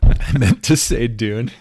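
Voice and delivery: mocking echo. Mockingly echoing the retold excuse.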